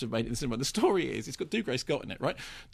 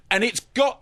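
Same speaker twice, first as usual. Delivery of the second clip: loud, higher mock bad acting; Gordon Ramsay; 8 semitones higher, 6 dB louder than usual. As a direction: ham it up like a stilted cameo. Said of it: "quite the worst cameo acting".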